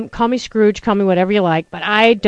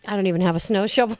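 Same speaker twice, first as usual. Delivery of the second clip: usual speaking voice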